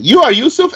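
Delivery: "You are Yusuf" lifted high with force; high-pitched, insistent "You are Yusuf"; exclamatory emphasis. Shown as a demonstration. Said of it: "exclamation point".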